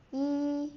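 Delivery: Yi held high and level, never dropping; first tone yi. Shown as a demonstration. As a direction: hold Yi high and flat like a first tone. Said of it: "first tone, never drop".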